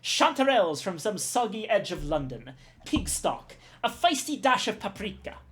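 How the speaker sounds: put-on a proud chef in a French accent that drifts Middle Eastern; bad French accent. Cook boasts of his new recipe, in a bad French accent. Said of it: "I'm trying to give him a French accent".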